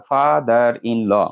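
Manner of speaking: father in law in four slow beats; father in law syllable by syllable; split into syllables. Shown as a demonstration. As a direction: separate fa, ther, in, law in father in law. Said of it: "একই তিনের অধিক আছে".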